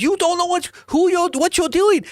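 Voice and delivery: very high-pitched imitated rant; hammed-up high screaming voice; retold screaming. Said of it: "He starts yelling and screaming".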